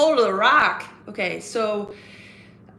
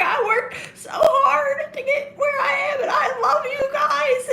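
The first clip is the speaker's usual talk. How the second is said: very high, drawn-out tearful plea; hammed-up crying promo; Charlotte Flair; slower than usual. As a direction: wail it slowly to a hostile crowd. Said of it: "She's like".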